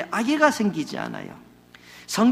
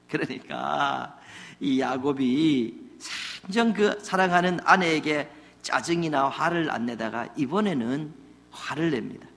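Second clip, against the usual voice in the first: ordinary talk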